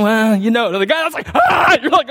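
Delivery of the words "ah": high-pitched imitated battle yell; sudden comic yell; mock intense yell. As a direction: burst out like an over-intense player.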